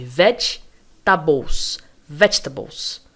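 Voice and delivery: vegetables split as VECH, tuh-bols, then joined; correct pronunciation. Shown as a demonstration. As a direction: break vegetables after a strong first VECH, then say it whole. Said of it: "É como se eu falasse". (vegetables como VÉTCH-tabous, primeira sílaba forte)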